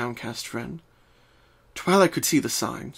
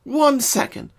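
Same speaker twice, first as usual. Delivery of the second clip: put-on higher, bright young mare voice; Twilight voice; 13 semitones higher, 4 dB louder, slower than usual. Twilight opens the door to Pinkie, higher friendly voice. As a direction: voice Twilight greeting her friend.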